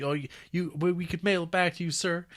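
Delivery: a hint of a grateful settler; polite traveler.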